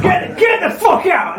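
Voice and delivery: high-pitched comic yell; mock outraged shout. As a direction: shriek it in mock outrage, drawn out. Mock outrage at wrong oxen.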